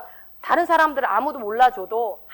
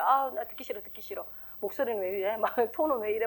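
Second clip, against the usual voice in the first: grumbling to himself; 3 semitones lower, 8 dB quieter than usual. Retold or imitated. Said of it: "모니터링 하는 것도 이제 싫을 때가 있어요".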